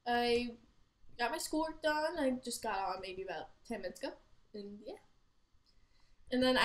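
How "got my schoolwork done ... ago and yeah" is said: usual speaking voice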